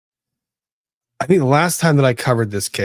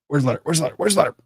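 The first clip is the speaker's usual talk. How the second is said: mock frantic search; 6 semitones higher, much faster than usual. Real, not acted. Searching for the letter, repeating.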